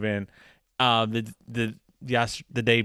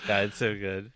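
normal talking voice

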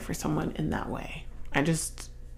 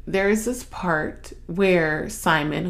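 ordinary talk

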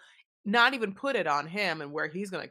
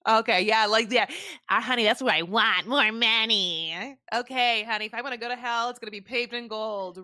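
mock greedy voice; 5 semitones higher, 7 dB louder than usual.

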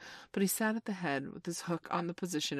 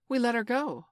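high, self-satisfied report; complacent; 8 semitones higher, 7 dB louder than usual. A narrator's reading. Smee telling Hook of the release, complacent. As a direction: report it as a job done. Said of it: "Smee answered complacently".

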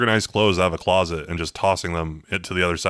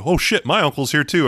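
high startled outburst; sudden alarmed exclamation; surprised; 8 semitones higher, 4 dB louder than usual. Retold or imitated. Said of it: "is like".

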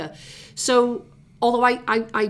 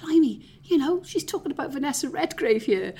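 higher, astonished blimey; amazed inner thought; 7 semitones higher than usual. Retold or imitated. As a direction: gasp at the name-dropping, pitched up. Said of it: "And I'm thinking".

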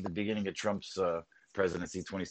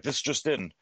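newscaster voice; 5 semitones higher, 3 dB louder than usual. Shown as a demonstration. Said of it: "a newscaster voice".

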